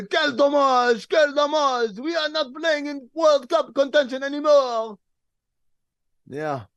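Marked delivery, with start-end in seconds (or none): 0.0-5.0 high, loud, slow French wail; mock French lament, pitched high; French accent.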